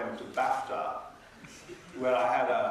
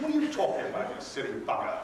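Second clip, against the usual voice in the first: acted affronted retort; indignant outburst; 3 semitones higher, faster than usual. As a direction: burst out in comic exasperation. Sudden indignant retort after insult.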